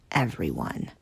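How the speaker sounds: soft furtive whisper. Acted. Tank whispering to the kids, secret whisper. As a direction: keep it hushed.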